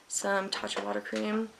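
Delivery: ordinary talk, nothing marked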